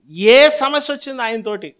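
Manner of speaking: high, loud outcry; loud exhortation. Real, not acted. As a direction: cry it out to the congregation. Preacher calls out loudly. (గట్టిగా ఎత్తుగా ప్రబోధించడం)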